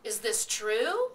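slow, loud question swooping up high; wide-eyed, rising disbelief; eager. A narrator's reading. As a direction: ask it greedily, stretching the last word. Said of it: "Templeton's eyes were blazing".

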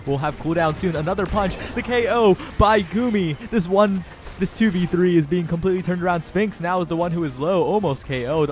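excited play-by-play. Real, not acted. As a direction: build the hype as the KO lands. Caster calling a comeback KO.

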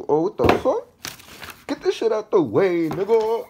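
high, loud hyped yell; excited shout. Real, not acted. Vlogger shouting as the box opens, high and loud. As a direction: burst out at the sight. Unboxing surprise.

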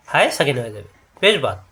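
ordinary delivery

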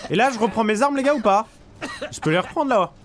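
loud, high, laughing question; excited laughing. Real, not acted. Excited, laughing while asking chat.

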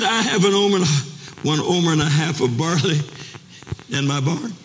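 high-pitched, insistent pleading; impassioned plea. Acted. Poor father offering his winter barley, high impassioned plea.

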